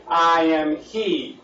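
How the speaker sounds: "I am he" spoken very slowly; slow solemn declaration. Retold or imitated. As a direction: lay each word down with weight. Jesus' words quoted again, emphatic.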